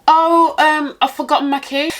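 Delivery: a hint of an old lady at the door. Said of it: "It was the old lady downstairs".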